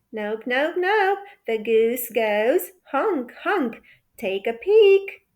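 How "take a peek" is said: usual speaking voice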